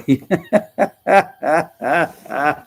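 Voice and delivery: loud, high burst of laughing; hearty laughter. Real, not acted. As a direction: laugh out in a long staccato run. Laughing at the bellboy joke.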